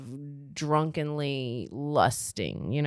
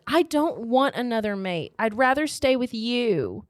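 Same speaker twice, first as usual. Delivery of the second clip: high, raised heartfelt protest; insistent plea; 5 semitones higher than usual. Acted. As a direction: act the heroine pleading her case. Heroine insists she wants to stay.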